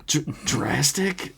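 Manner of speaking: mildly spluttering, stuck on the first sound; coughed out. A narrator's reading. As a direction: choke the word out slowly with a catch. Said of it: "Johnny coughed out".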